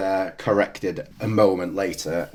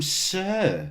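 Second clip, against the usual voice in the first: imitated nurse; 9 semitones higher, 6 dB quieter than usual. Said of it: "she calls him".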